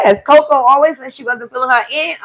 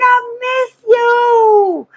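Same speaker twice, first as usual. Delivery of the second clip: tender tearful plea; 9 semitones higher, much slower than usual. Retold or imitated. Telling Golden she misses her.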